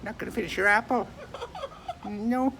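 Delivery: high cooing pet talk. Real, not acted.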